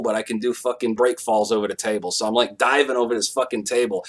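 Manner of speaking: usual speaking voice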